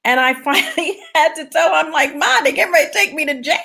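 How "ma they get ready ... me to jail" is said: laughing plea. Retold or imitated. Retelling pleading with her mother.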